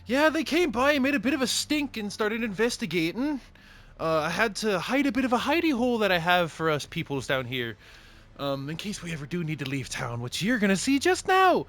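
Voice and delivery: very high, loud sing-song greeting; cheery shopkeeper. Acted. Dwarf shopkeeper greets the party in a high voice. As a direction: greet the customer bright and squeaky. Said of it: "and just says".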